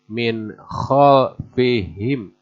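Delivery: Min khalfihim, slow with a rasping kh; clear idhar. Shown as a demonstration. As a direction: spell out the nun and kh. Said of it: "harus jelas".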